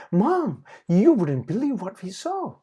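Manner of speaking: put-on high, loud, eager child voice; bursting to tell mom the news; breathless little piglet; excited exclaim. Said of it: "Patty exclaimed".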